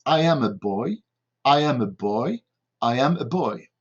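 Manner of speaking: same careful English phrase three times, evenly; repetition drill. Shown as a demonstration. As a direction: model I AM A BOY for repeating. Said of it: "je vais le répéter plusieurs fois".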